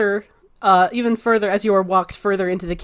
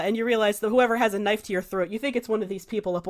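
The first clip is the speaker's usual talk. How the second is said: ordinary talk, nothing marked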